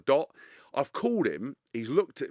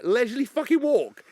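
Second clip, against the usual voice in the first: much higher, louder scoff; incredulous outrage; 10 semitones higher, 7 dB louder than usual. Retold or imitated. Reacting to a tabloid headline about his walk, outraged. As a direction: blow up at the ridiculous headline.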